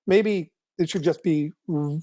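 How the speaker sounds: ordinary delivery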